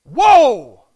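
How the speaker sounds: loud, high shouted exclamation; short explosive yell of disbelief; stunned outburst. Acted. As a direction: burst out in shock. Acted shock at not one positive thing.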